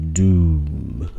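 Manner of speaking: booming, drawn-out doom. Shown as a demonstration. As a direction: intone it like a doomsayer. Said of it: "that's my doom voice".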